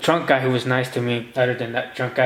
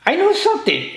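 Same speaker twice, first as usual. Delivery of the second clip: mock realization; 3 dB louder than usual. Inner reaction, quoted.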